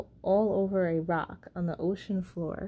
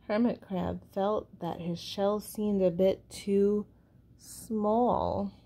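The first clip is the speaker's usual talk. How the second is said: ordinary delivery, nothing marked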